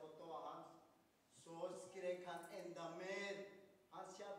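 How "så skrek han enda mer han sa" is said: plain reading voice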